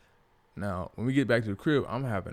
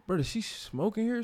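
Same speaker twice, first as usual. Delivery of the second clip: shocked question; 10 semitones higher than usual. Retold or imitated. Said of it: "So I'm like".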